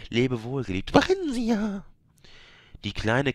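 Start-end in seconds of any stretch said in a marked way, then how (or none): 0.1-1.8 high, lifted parting cry; high ringing farewell; tender farewell call. A narrator's reading. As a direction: parents bid their daughter goodbye. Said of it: "riefen sie".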